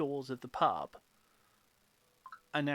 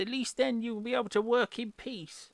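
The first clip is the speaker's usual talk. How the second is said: sassy barmaid voice; 6 semitones higher, 4 dB louder than usual. Acted.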